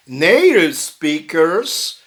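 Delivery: ordinary delivery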